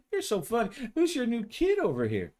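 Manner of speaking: high, mocking teasing voice of a cop colleague; a cheeky female cop ribbing her partner; teasing coworker. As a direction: needle him sweetly, high and sly. Kathy teasing again.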